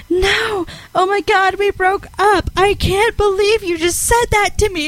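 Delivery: mock-hysterical high-pitched freak-out; berserk outburst. Friend blows up when asked about her boyfriend, retold berserk outburst. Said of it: "completely goes berserk on me".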